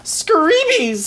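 high loud scream of fright. Acted. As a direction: shriek it in terror. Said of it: "screamed Sir Snips".

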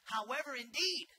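preacher reading Paul's emphatic contrast; emphatic however indeed. Shown as a demonstration. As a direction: lift and punch however, indeed. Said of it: "Paul's being emphatic here".

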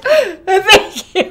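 high, loud thank-you through laughter and tears; laugh-crying thanks. Retold or imitated. Sobbing woman thanking her friend, laughing through tears. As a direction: gasp it out half laughing, half crying.

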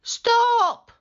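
loud, very high panicked shout; shrieked command; boy's cry. A narrator's reading. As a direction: cry out high as the alarmed boy. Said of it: "cried the boy".

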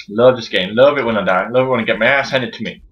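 low sarcastic litany; deadpan sarcasm. Real, not acted. Gamer getting beaten, low deadpan sarcasm. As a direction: repeat it low, in mock devotion. Sarcastic after dying again.

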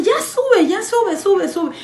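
high excited urging with a laugh; giggly eager summons; urgent sube. Retold or imitated. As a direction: call her up, laughing and high. Coworker urging her upstairs.

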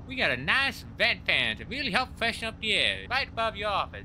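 high-pitched Texan character voice; Texan accent. Acted. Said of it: "you gotta go full Texan".

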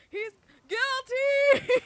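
high, loud, slow accusing cry; dramatic accusation. Pretend. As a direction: cry it out like a verdict.

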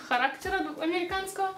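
usual speaking voice

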